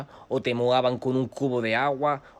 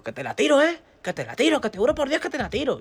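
high, raised-voice warning, retold; insistent threat; 4 dB louder than usual. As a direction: swear it loudly as a dare. Repeated threat to drop the broom.

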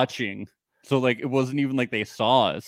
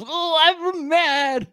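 much higher, slow, hammed-up whiny voice; mock her fake tantrum; mocking Sam; much slower than usual. Mocking Sam's fake anger after the decision, high and drawn out. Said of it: "she's gonna bang her hands around".

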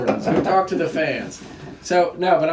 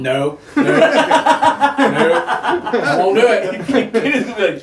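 loud laughing no; laughing refusal; 5 semitones higher, 6 dB louder, much slower than usual. Real, not acted. Refusing milder wings, laughing.

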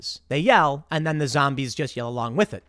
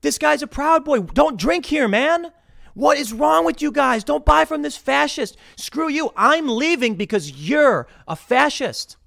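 high, loud pointing accusation; mock accusing shout; 9 semitones higher, 4 dB louder than usual. Advising Elad to accuse the bartender, loud and high. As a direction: call him out loud across the bar. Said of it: "Be like".

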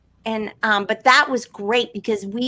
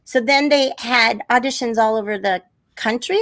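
ordinary delivery

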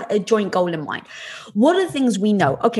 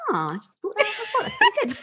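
breaking into high laughter mid-sentence; can't get the words out for giggling; laughing at herself; 13 semitones higher than usual. Real, not acted. Host laughing at her own airbag idea, giggling.

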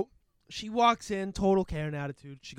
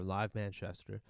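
"Live Manchester" said slower and softer, savoring the name; slow mocking repeat; 7 semitones lower, 9 dB quieter, slower than usual. Shown as a demonstration. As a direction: drag out "Live Manchester" with dry mockery. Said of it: "an event called Live Manchester".

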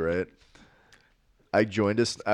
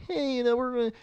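very high-pitched put-on chatter; kids' high voices. Player mimicking himself and young clanmates, high kiddie voice. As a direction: imitate squeaky young players. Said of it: "'cause we were all like".

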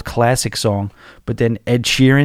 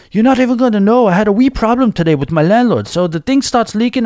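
high-pitched mock Irish chatter; excitable Irish storyteller, pitched way up; bad Irish accent; 10 semitones higher than usual. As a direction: launch into her story in a badly faked Irish accent. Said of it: "I can't do an Irish accent".

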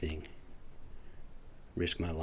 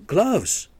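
exasperated outburst; 10 semitones higher, 8 dB louder than usual. Real, not acted. Ranting about the cashier.

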